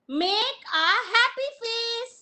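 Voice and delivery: teacher calling out the game instruction; Simon Says command. Shown as a demonstration. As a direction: announce the command to the class. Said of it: "teacher giving Simon Says command".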